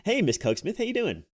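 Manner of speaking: ordinary delivery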